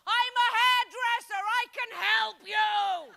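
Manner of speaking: high, loud slow shout from the back; hammed-up heckler shout. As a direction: yell it across the room. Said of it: "someone ... shouted out".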